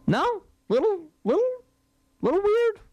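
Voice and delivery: very high, squeaky questioning; mock disbelief. Real, not acted. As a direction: pitch way up in teasing doubt. Mock-doubting the big contract.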